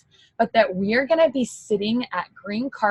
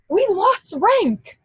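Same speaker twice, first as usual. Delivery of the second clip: near tears, pushing it out; real emotional outburst; overwhelmed; 10 semitones higher than usual. Real, not acted. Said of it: "I'm shaking".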